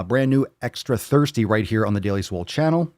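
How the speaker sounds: ordinary delivery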